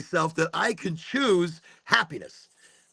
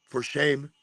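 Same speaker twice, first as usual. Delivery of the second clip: restrained mock scolding; mock disapproval. Onlooker judging his mirror talk, restrained mock shaming. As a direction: scold in a put-on voice, barely raised.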